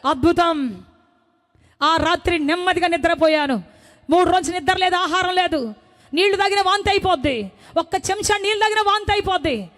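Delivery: normal talking voice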